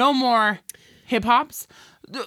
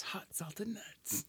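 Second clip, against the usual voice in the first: whispering; 3 semitones lower, 22 dB quieter, slower than usual. Acted. Whispered repeat.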